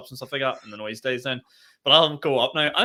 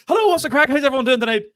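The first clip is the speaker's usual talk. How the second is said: much higher, loud, over-the-top hello; manic compere greeting; 13 semitones higher, 8 dB louder, faster than usual. Retold or imitated. Comic sprinting to the mic greets crowd, manic and loud. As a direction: burst onto stage greeting the crowd like a maniac. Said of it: "Like a psychopath".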